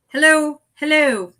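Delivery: loud, high mock British accent; a hammed-up British hello. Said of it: "This is my British accent".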